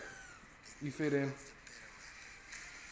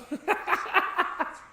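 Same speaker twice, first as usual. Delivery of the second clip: high breathy laugh; 4 dB louder than usual. Real, not acted. Laughs at the word.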